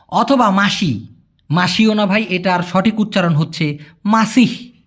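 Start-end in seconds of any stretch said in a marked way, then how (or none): none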